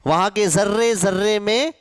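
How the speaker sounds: normal talking voice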